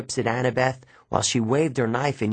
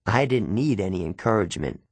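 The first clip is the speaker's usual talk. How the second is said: usual speaking voice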